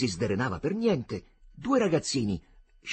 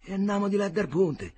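resigned echo; 7 semitones higher than usual. Acted. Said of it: "disse Agnolo".

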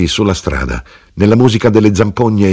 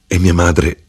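ordinary delivery, nothing marked

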